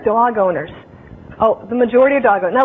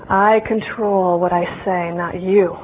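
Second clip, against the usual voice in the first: cold cat voice; 6 semitones lower, slower than usual. Acted. Cat voicing its cold silence.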